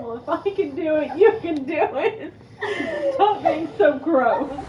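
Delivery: laughing, mock scolding; laughing mock thought. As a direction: scold her playfully while laughing. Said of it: "'Cause you're thinking".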